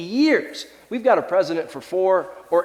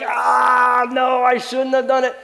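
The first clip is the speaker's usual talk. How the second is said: higher, louder hammed-up groan of regret; mock self-blame shout; 7 semitones higher, 5 dB louder, slower than usual. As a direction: yell out a mock confession of guilt. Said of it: "any self-deprecation you have, any like".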